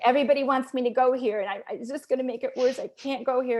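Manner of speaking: hurried, pressured pleading; panicky protest. Retold or imitated. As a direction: blurt it out fast, resisting. Retold protest to prayer leader.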